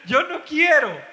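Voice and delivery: "Yo no quiero" repetido entre risas, agudo; laughing mock refusal. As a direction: burlarse riendo de "Yo no quiero". (imitación burlona, riéndose, de "Yo no quiero")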